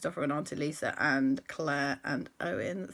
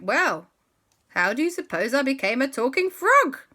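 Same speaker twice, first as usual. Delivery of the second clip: knowing retort; 7 semitones higher, 9 dB louder than usual. Acted. Frog pointed reply.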